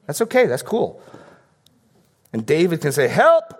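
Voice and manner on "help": high, louder shout of Help; desperate cry for help. Acted. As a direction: cry out Help in real need. David crying out for help.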